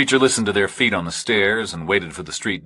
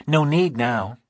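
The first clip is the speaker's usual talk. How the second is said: higher, lighter woman's voice, unhurried; Dee Marie's voice; 6 semitones higher, slower than usual. A narrator's reading. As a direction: read her as a steady, practical woman.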